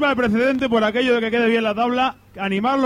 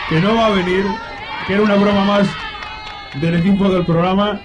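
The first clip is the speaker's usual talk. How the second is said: louder repeat for someone who missed it; to someone hard of hearing; 3 dB louder than usual. Real, not acted. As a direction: say it again, louder and clearer. ¿Qué?.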